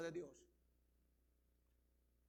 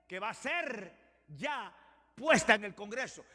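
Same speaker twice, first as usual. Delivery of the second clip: normal talking voice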